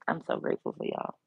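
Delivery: soft breathy whisper of thanks. Real, not acted. Streamer thanking her viewers, whispered and soft. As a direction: whisper it, touched.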